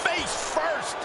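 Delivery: high-pitched shocked play-by-play; wrestling commentator's high yelp; excited call. Real, not acted. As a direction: call the painful landing as it happens. Commentator reacting to a hard hit.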